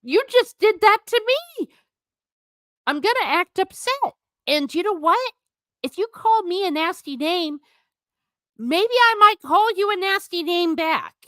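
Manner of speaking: sing-song mimic of the victim answering back; mock retort. Imitating the hurt person talking back, high and mocking. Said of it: "It's like".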